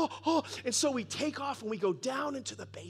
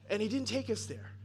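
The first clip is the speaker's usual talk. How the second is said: normal talking voice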